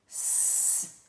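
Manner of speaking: "S" as a long pure hiss; demonstrated S sound. Shown as a demonstration. Teacher showing the sound of S, sustained hiss. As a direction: hiss the S, no vowel. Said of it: "nós temos o S".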